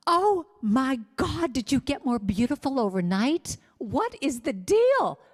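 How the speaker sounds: high, delighted gushing, slowed on the exclamations; overjoyed high squeals at your own body; gushing mirror praise. Pretend. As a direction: rave at your reflection like you can't believe it. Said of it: "look at yourself in the mirror and go".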